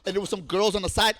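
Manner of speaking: ordinary talk, nothing marked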